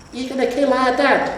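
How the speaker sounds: put-on high child voice, wary; suspicious troll child. A narrator's reading. Troll son asking the hero, suspicious and high. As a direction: eye the stranger suspiciously. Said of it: "said Scree suspiciously".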